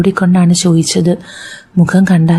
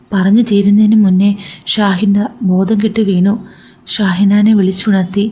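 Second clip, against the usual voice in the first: ordinary delivery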